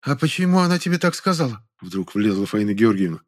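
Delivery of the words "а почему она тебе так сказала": higher-pitched curious interjection; inquisitive woman. A narrator's reading. Neighbour suddenly butts in about the quarrel, inquisitive. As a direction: cut in with a high, probing question.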